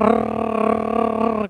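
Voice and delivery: long, low rolling trill like a motor; motorboat lip trill. Shown as a demonstration. Trainer demonstrating the breathing drill, motorboat trill. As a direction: trill steadily on one breath. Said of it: "kayak gitu".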